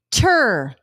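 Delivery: "tur" said as a clipped onset sound; isolated onset "tur". Shown as a demonstration. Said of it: "Say the onset of track".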